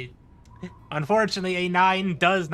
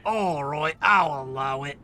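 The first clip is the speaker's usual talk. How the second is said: lower, haughty sheriff granting leave; lightly voiced pompous sheriff; Sheriff of Nottingham voice; 3 semitones lower than usual. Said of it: "That's my Sheriff of Nottingham voice".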